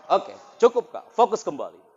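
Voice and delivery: loud, high call to refocus; teacher ordering the room to refocus. Real, not acted. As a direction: pull everyone's attention back. Quieting the crowd.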